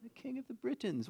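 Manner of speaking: high, soft English king, insistent; mock English accent. King answers puzzled peasants, high mock English accent. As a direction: repeat the title in a hammy English accent.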